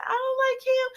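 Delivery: put-on very high child voice, shy denial; little girl denial. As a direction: squeak it out like a bashful kid. Violet denies a crush.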